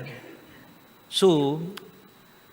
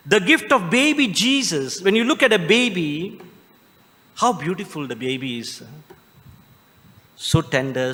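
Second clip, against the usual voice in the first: usual speaking voice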